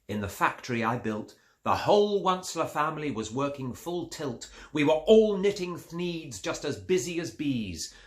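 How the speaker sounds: usual speaking voice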